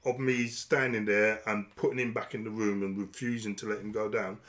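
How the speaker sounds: ordinary talk, nothing marked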